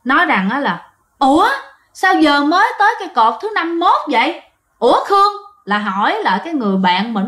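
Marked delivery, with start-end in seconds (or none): 1.2-5.3 higher, puzzled exclamation; startled cry-out. Acted. A hiker spots post fifty-one and cries out, startled. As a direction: call out in mild bewilderment. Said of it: "có một đứa la lên".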